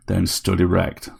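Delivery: usual speaking voice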